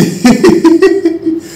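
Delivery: high-pitched teasing cackle; high giggling laugh. Acted. Father joking about year-round feasting, high giggle.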